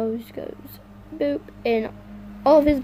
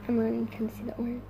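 quick hushed whisper; excited secret whisper while opening; fast whisper; 2 semitones lower, 9 dB quieter, much faster than usual. Real, not acted. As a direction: whisper it fast, peeking inside. Peeking at the blind bag.

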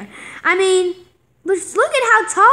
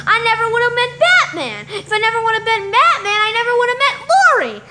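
rising rush of a realisation; building excitement; 5 semitones higher, faster than usual. Acted. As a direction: speed up as it dawns on him. Building realisation, spoken faster.

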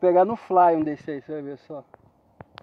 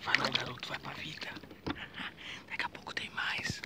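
soft unvoiced whisper; 3 semitones lower, 13 dB quieter than usual. Real, not acted. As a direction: whisper so the fish isn't spooked. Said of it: "vou falar baixinho".

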